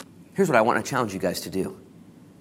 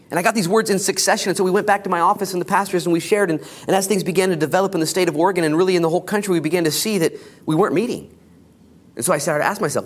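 preaching; 8 semitones higher, 4 dB louder, faster than usual. Acted. Fast loud sermon narration.

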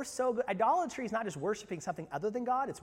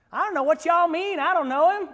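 hammed-up Galilean twang, much higher and louder; a country Galilean twang, near a shout; 11 semitones higher, 12 dB louder than usual. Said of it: "I can hear the twang, right? The Galilean accent".

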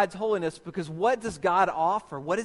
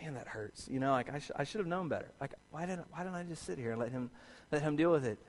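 much softer, muttering to himself in pain; hushed self-pitying mutter; 3 semitones lower, 11 dB quieter, faster than usual. Retold or imitated. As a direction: grumble quietly after the sting. Sitting hurt in office, muttering.

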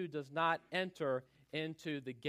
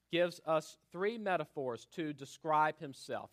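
ordinary talk, nothing marked

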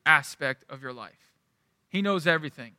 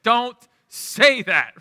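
slow, louder, higher exasperated plea; exasperated protest; 5 semitones higher, 6 dB louder, much slower than usual. Retold or imitated. Teen to parents over an irritating phrase, exasperated. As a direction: drag it out, fed up with the phrase.

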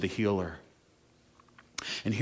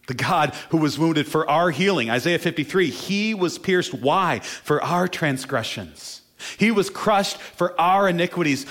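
louder, higher preaching at full force; fervent preaching; 7 semitones higher, 5 dB louder than usual. Acted. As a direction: drive it home from the pulpit.

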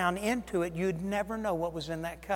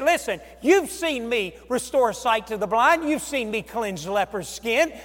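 building rebuke; 5 semitones higher, 10 dB louder than usual. Acted. Jesus reminding the disciples, rising to a loud cry. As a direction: build each line louder until it peaks.

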